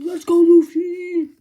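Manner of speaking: hammed-up cheer. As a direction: roar it like a cheering crowd. Crowd cheering for Luffy.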